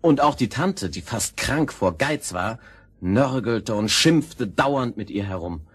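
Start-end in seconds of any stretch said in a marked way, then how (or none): none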